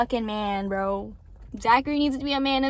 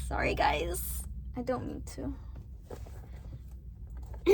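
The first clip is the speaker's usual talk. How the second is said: whispered apology; 11 dB quieter than usual. Real, not acted. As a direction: whisper it, a little embarrassed. Apologizing after ranting to viewers.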